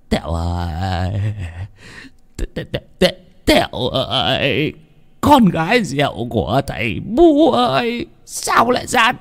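high, drawn-out stammering wail; blubbering crying. Acted. Father crying over his hanged daughter, blubbering wail. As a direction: wail slowly, breaking into stuttered sobs. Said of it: "mếu máo khóc".

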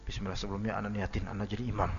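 lightly mocked imam intention; mock imam announcement. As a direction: poke fun at an overly formal imam.

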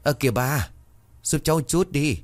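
high-pitched, pressing plea; pleading lad urging an elder on. Acted. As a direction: hurry her along to help. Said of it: "thì nó hối".

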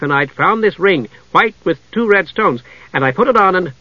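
high, eager kender rambling; excited chatter. A narrator's reading. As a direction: rush on with delight in the story. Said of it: "highly excited".

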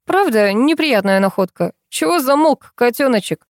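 sly, goading jab; snide. A narrator's reading. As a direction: rub it in with false sympathy. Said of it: "ехидно протянул Лёва".

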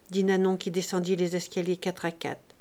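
plain reading voice

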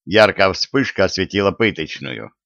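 ordinary delivery

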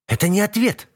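high, sharp aggressive snap. A narrator's reading. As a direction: throw it out aggressively. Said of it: "агрессивно бросает ястреб".

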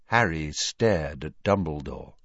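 plain reading voice

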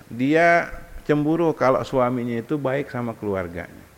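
ordinary talk

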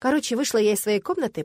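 plain reading voice

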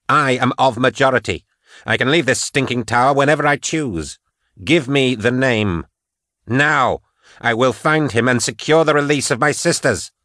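high, forceful demand; angry, determined ultimatum; defiant Patience. Acted.

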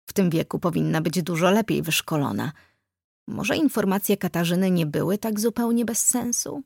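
usual speaking voice

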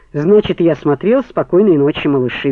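usual speaking voice

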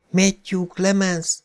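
put-on high, slow eerie child's whisper; the drain-voice whispers its name; whispering ghost voice. A narrator's reading. Voice from the drain whispers to Beverly, high and slow. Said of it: "súgta a hang".

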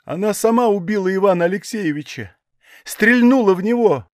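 rising high and tearful, outraged; indignant tears. A narrator's reading. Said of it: "негодующие слёзы".

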